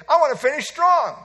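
preacher shouting his resolve, loud and high; loud declaration. Shown as a demonstration. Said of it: "You know what I say?".

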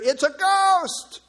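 high, drawn-out frightened cry; crying out in fear. Acted. Preacher acting the troubled disciples, crying out in fear. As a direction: cry it out in terror, stretching the words. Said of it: "crying out in fear".

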